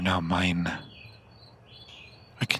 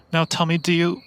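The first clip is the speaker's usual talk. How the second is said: ordinary talk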